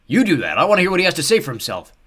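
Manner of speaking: loud, high-pitched holler; hollering back. A narrator's reading. Someone in the crowd answering another man, hollering back. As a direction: shout the retort back across the crowd. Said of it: "someone else hollered back".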